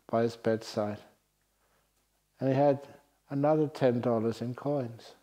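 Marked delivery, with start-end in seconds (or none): none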